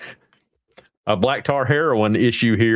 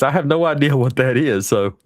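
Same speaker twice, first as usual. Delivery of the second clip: normal talking voice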